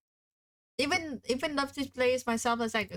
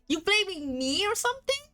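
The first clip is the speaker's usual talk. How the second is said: mock indignant; 7 semitones higher than usual. Mock accusation in role.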